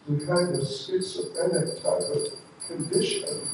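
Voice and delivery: plain reading voice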